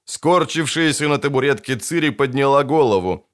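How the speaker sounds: ordinary delivery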